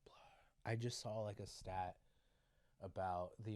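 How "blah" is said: breathy whispered aside; whispering. Real, not acted. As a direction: whisper it under your breath.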